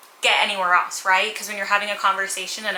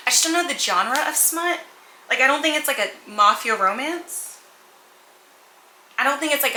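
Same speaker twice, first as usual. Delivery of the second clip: normal talking voice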